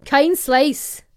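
Scottish accent attempt. Shown as a demonstration. Co-host trying the hack, emphatic Scottish accent attempt. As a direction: narrow the vowels for a Scottish accent. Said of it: "in a Scottish accent".

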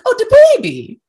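squeaky, high mock swoon over the baby; hammed-up cooing at a baby; mock high squeal. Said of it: "it was like".

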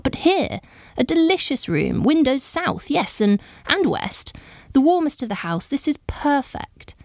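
high-pitched, gushing exclamations; delighted cry. A narrator's reading. Visitor discovering a warmer room for Lady Jane, crying out delightedly. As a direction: exclaim over each discovery, voice leaping high. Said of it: "cried out".

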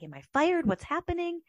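blindsided and scrambling to understand; panicked confusion. Retold or imitated. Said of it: "I was like".

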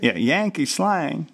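caricature Yankee accent. Shown as a demonstration. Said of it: "caricature instead of accent".

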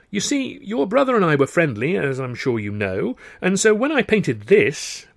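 smooth explaining. Acted. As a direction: placate him with an innocent explanation. Smoothly fobbing off the suspicious brother.

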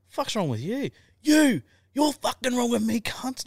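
high, hammed-up angry mate; mock outraged bloke; mate's angry quote. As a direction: mimic the furious mate mockingly.

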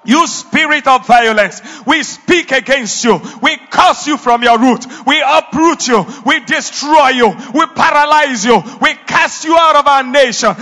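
loud commanding rebuke; laying a curse. Real, not acted. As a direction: cast it out with force. Rebuking the spirit of violence.

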